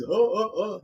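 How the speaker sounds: high mock belly laugh; hammed-up "oh, oh, oh" laugh; imitated laughter. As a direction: act out an old man cracking up. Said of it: "he's laughing with his head back".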